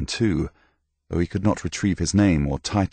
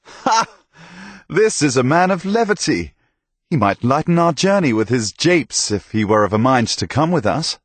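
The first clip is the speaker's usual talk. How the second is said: much higher and louder, jovial; amused hearty knight; 8 semitones higher, 4 dB louder than usual. Acted. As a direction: laugh him up as good company.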